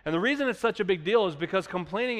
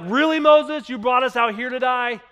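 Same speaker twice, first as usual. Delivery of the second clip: much higher, louder mock-sarcastic whine; 7 semitones higher, 8 dB louder than usual. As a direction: sneer at Moses, pitched way up. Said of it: "they're being sarcastic".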